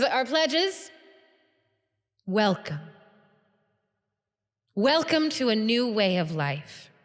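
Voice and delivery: spaced phrases, each given room to land; grand welcoming speech. Acted. Guild leader welcomes new pledges, grand formal address.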